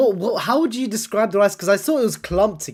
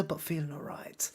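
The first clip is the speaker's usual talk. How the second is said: whispered aside; 4 semitones lower, 17 dB quieter than usual. Real, not acted. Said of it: "still a bit under the weather".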